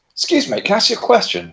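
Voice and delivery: a mild put-on of an earnest customer asking a question; customer's polite question.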